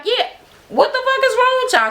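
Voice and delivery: very high, outraged exclaim; indignant yell. Retold or imitated. Minnie confronting the group, very high outrage. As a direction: shriek the accusation. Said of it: "she was like".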